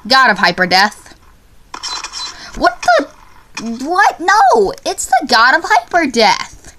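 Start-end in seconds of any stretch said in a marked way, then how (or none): 2.6-6.7 high-pitched disbelief; incredulous protest. Real, not acted. Gamer told his right answer is wrong, incredulous protest. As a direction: protest as if cheated.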